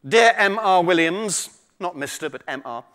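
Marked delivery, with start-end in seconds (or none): 0.1-1.5 put-on slow, loud posh little girl. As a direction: read it in a posh voice. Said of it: "I'm gonna have to use my posh voice for this".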